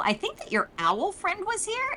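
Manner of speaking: high ghostly Garros. Retold or imitated.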